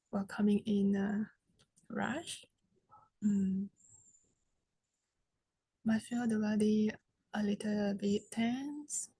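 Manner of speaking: soft, unhurried meditation guidance with long pauses; guided meditation. Real, not acted. Teacher guides seated group to relax the body, soft meditation voice. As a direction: settle them gently, leaving long silences between phrases.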